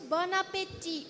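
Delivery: "bon appétit" with a nasal French bon and the stress on the last syllable. Shown as a demonstration. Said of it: "Can we try to repeat that?".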